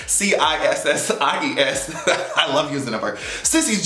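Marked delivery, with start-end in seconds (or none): 0.1-1.9 letter by letter, "C I S S I E S"; spelling it out. Shown as a demonstration. Vlogger spelling the word for viewers, letter by letter. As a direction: spell out "C I S S I E S" one letter at a time, amused. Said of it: "Sissies and".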